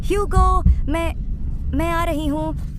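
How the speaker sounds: high, loud shout; frantic calling. Acted. Sister calls that she's coming, frantic shout. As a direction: shout reassurance across a distance.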